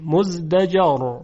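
مزدجر with the final ر silent and heavy, tafkhim; heavy r in pause. Shown as a demonstration. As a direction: say مزدجر slowly, stopping on a thick ر.

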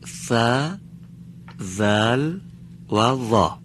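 ث and ذل thin, ظا heavy; name ث, ذل and ظا clearly; ث ذل ظا, thin, thin, thick; three letters said. Shown as a demonstration.

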